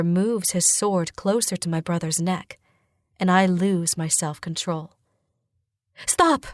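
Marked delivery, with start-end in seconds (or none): 6.1-6.4 sudden high-pitched cry to halt. A narrator's reading. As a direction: call out urgently, pitch leaping up. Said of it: "I cry".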